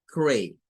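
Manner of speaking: cray with a long a; cray like the word play; cray repeated for practice; syllable cray. Shown as a demonstration. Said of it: "suena a A".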